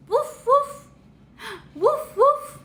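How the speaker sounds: light, high puppy barks; puppy woof. Pretend. Teacher showing a toddler the puppy's sound, high playful woofs. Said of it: "What sound does a puppy make?".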